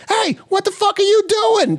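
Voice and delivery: put-on bust in yelling like an outraged kid; mock outraged yell. Ricky catches Artie unbuckling.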